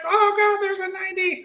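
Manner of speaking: very high, strained retold cry; mock panic. Driver suddenly meeting a ninety, mock panic. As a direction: blurt it in comic alarm.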